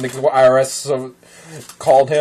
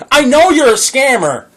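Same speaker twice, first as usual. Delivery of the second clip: ordinary delivery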